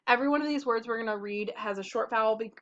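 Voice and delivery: normal talking voice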